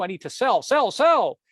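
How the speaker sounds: building to a high, loud cry on the last sell; rising panic, sell sell sell; panicked selling. Acted. Cartoon man panicking on phone.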